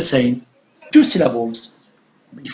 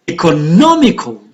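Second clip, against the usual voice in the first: "Economical" with a raised, stressed "nom"; stress on third syllable; 2 semitones lower than usual. Shown as a demonstration.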